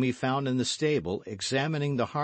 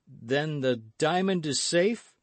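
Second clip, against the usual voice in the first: tentative question, pitched higher; mildly anxious, high questioning voice; timid; 7 semitones higher, 3 dB louder than usual. A narrator's reading. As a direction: ask timidly, hoping for reassurance.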